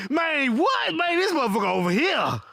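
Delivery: disbelieving gossip; incredulous gossip. Retold or imitated. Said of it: "She was like".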